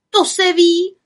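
high, loud, cross retort; angry outcry. A narrator's reading. Little witch slamming her spellbook, angry cry. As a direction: snap it out crossly. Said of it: "vykřikla zlostně".

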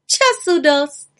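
high, drawn-out dismissive call; loud little witch, unhurried. Acted. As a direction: call it out slowly, brushing off the worry. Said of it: "zvolala malá čarodějnice".

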